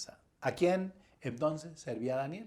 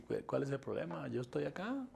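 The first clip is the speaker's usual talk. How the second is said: much softer, unbothered quoted answer; calm reply; 11 dB quieter than usual. Acted. The Lord answering frightened disciples, calm and hushed. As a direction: drop right down, calmly reassuring.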